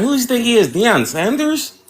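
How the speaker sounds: laughing. Real, not acted. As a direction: laugh through the question. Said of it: "laughing while asking".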